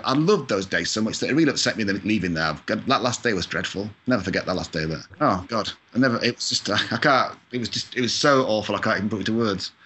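ordinary delivery

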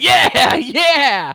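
loud, high whooping yeah; excited cheer. Retold or imitated. Pizza driver cheering TV wipeouts, excited yelling. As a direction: cheer hard at the carnage. Retold cheering at crash videos.

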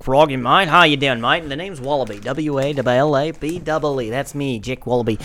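put-on Australian bloke voice; Jack Wallaby, Australian accent. Introducing his made-up character, Australian accent. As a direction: play a chummy Australian character. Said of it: "Like Jack Wallaby".

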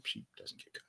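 conspiratorial whisper; hushed gossip aside. Real, not acted.

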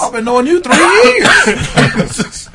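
laughing loud and slow through the words. Real, not acted. Arguing with his friend, laughing hard. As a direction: crack up while insisting.

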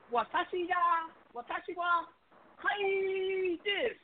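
high put-on Japanese phrase; mock Japanese. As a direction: fumble some mock Japanese. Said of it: "I just said I'm high right now".

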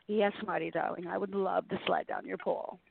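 low, breathy-sounding phone-sex line voice, hammed up; sultry hotline voice. Co-host on air answering a flirt in a mock hotline voice. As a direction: purr it like a nine seven six hotline operator, half joking. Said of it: "I gave him a nine seven six voice".

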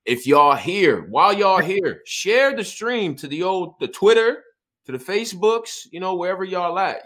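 loud, high hype to viewers; rally the audience to share; hype call-out. Real, not acted.

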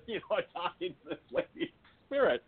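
high burst of laughter, then talking through it; laughing. Real, not acted. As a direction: laugh it out, embarrassed. Laughs at embarrassing memory.

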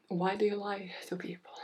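hushed, breath-only reproach; whispered scolding. Pretend. Host scolding herself for broken promises, whispered reproach. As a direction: whisper the accusation to yourself.

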